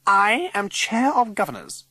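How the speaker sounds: high, imperious announcement; haughty declaration. A narrator's reading. Governor facing down the old lady, haughty declaration. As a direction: pull rank grandly.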